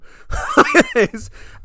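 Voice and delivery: high, loud laugh after the punchline; burst of laughter. Real, not acted. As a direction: crack up at the punchline. Laughs at his own story.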